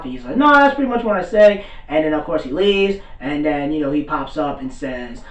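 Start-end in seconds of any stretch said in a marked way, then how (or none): 0.4-1.6 high, loud imitated comeback; defiant retort. Gojo answers the old guy's warning, defiant. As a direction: push back higher and louder. Acted retort to watch your mouth.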